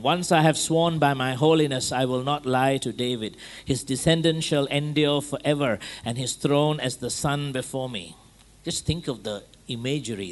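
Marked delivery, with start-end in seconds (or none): 0.0-8.2 full-force scripture reading; scripture proclamation. Acted. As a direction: declare the verse like a prophet from the pulpit.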